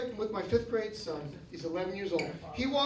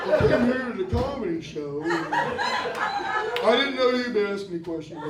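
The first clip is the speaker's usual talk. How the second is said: smug retiree; 5 dB louder than usual. Acted. Continuing the audience member's voice.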